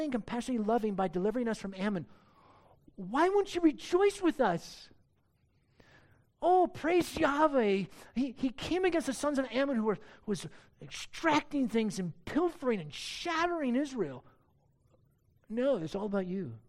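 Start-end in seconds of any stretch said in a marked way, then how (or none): 3.0-4.9 pleading question. Acted. Preacher voices plea to Ephraim. 6.4-14.2 mock rejoicing. Voicing the rejoicing Israelites.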